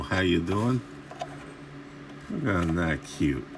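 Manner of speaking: ordinary delivery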